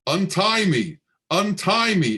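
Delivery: shrill loud scream. Acted. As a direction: scream it like a trapped child. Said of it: "in the same shrill, loud voice".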